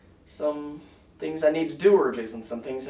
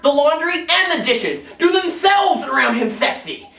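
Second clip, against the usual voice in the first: loud, high, rousing spoken-word anthem; slam poetry; 8 semitones higher, 8 dB louder than usual. Acted. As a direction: proclaim it like an anthem for the underdogs. Performs a slam poem.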